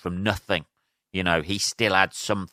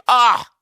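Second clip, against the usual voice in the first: much higher, loud cry of glee; gleeful yelp; 10 dB louder than usual. Real, not acted. Remembering hanging up on callers, a loud gleeful yelp. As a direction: yelp with joy at the memory.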